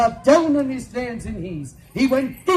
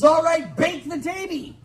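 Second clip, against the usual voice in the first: higher, louder give-in; mock shout; 6 semitones higher, 3 dB louder than usual. Husband giving in to the witch, mock shout. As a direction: shout out the surrender. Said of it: "He says".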